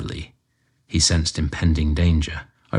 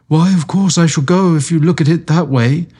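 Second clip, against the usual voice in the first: higher, flustered giving in; horrified client; 9 semitones higher, 4 dB louder than usual. A narrator's reading. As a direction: back down in a hurry.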